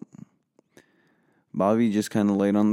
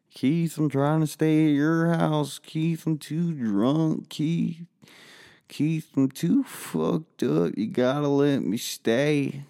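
put-on higher, slow drunken pleading; whiny begging; 7 semitones higher, slower than usual. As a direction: wheedle like a drunk kid. Drunk kid begging to stay.

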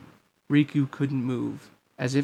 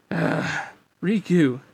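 groan, then a louder higher call; pained call; 4 semitones higher, 3 dB louder, slower than usual. A narrator's reading. Sora struggling up, calling to his friend, strained. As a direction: grunt from the blow and call out.

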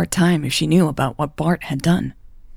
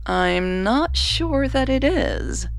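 higher, slow, doubtful reply; 9 semitones higher, much slower than usual. A narrator's reading. Sister doubts that all is well, replying slowly. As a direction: stretch it out hesitantly. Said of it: "her sister replied slowly".

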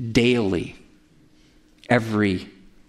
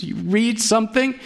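ordinary talk, nothing marked

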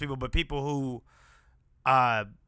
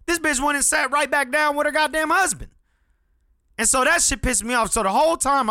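angry; 12 semitones higher, 3 dB louder than usual. Real, not acted. Angry retelling, rising anger.

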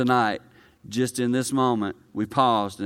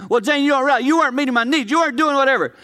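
much higher and louder, griping list; mock complaining husband; 14 semitones higher, 7 dB louder, faster than usual. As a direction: whine out every complaint at Jane. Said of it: "I can say".

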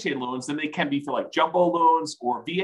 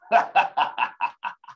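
breathy evil villain cackle; maniacal laugh. Acted. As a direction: cackle like a mad schemer. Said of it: "I don't know why I did that maniacal laugh".

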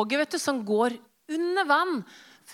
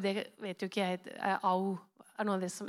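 ordinary talk, nothing marked

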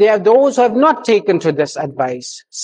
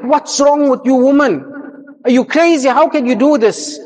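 higher-pitched, incredulous retold protest; shocked outburst; 7 semitones higher than usual. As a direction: act out a stunned husband crying out at his wife. Husband's shocked outburst at wife.

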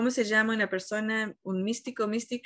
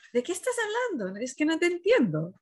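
mock bewildered, higher and quick; baffled reply; 7 semitones higher than usual. A person hearing the ocean ask for water, mock baffled. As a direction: play it as utterly lost, lightly. Imitating confused reaction, "es like.

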